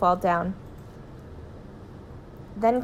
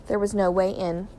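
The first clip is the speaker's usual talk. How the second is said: ordinary delivery, nothing marked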